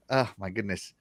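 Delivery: ordinary delivery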